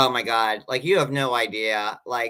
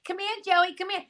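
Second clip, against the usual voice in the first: high sing-song call across a room; 12 semitones higher than usual. Retold or imitated. Mother calling her son to come over, high call.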